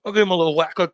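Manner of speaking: usual speaking voice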